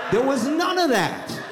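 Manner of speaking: squeaky high voice, laughing; mock high denial. Comic insisting nobody ever did that, squeaky high. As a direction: squeak it out in disbelief.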